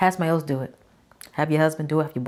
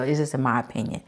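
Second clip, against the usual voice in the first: normal talking voice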